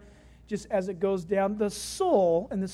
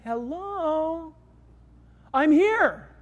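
drawn-out, raised-pitch hello; calling out; 5 semitones higher, much slower than usual. Acted. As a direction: call to someone who isn't noticing you. God calling to unheeding listeners.

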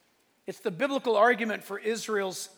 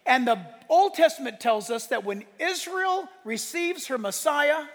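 impassioned preaching; 5 semitones higher, 6 dB louder than usual. Acted. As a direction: proclaim scripture at full force, voice raised.

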